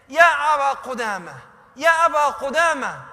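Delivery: loud, urgent rider's call. Retold or imitated. Rider calling to Abu Qudama, loud urgent call. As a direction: shout the name after someone. Rider calls after the narrator.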